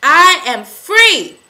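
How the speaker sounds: a high, ecstatic scream of release; full-lung scream. Real, not acted. Woman screaming her freedom to the viewers, triumphant scream. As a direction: scream it out with everything you have. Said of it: "I screamed at the top of my lungs".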